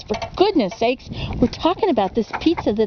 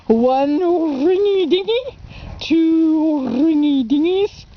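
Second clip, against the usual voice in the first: high, slow nasal-less operator voice impression; Laugh-In operator; 5 semitones higher, much slower than usual. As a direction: drawl it slowly like the Laugh-In telephone lady. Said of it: "as that lady on Laugh-In says".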